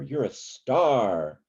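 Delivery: You're a star with a long, strong STAR; slow, leaning hard on star; stress on STAR. Shown as a demonstration. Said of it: "same thing with".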